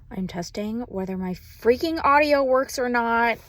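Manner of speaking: ordinary talk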